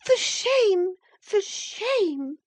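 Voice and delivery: high, indignant outcry; angry reproach. A narrator's reading. Indignant girl to her sister-in-law, angry reproach. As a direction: cry it out in outrage, twice over. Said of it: "she repeated angrily".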